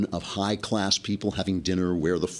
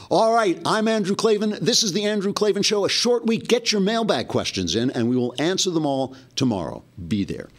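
high-pitched, projected host outro; energetic radio-style wrap-up; show sign-off; 11 semitones higher, 3 dB louder than usual. Acted. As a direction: punch out the closing announcement, much higher than chat. Show host's closing sign-off.

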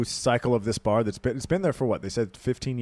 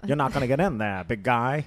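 put-on a light 1930s New York gangster accent; tough-guy New York speakeasy doorman; old New York accent. Speakeasy bouncer turning away a copper, New York accent. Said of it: "You're so good at accents".

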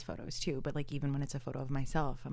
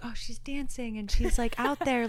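higher, louder mock-awed inner voice; wide-eyed admiration; 9 semitones higher, 6 dB louder than usual. Friend retells scrolling through posts, lightly awed.

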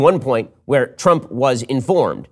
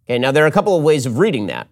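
ordinary talk, nothing marked

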